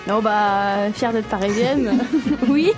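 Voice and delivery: ordinary talk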